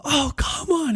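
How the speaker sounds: high exasperated groan; exasperated cry. Retold or imitated. Dad reliving his frustration in the car, exasperated. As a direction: let it out like you can't believe it. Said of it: "I was serious about it".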